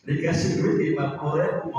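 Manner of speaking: usual speaking voice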